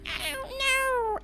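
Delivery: put-on very high, drawn-out little girl wail; put-on squeaky little-girl cry. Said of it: "in a little girl voice".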